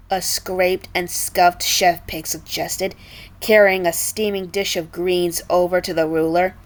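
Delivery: plain reading voice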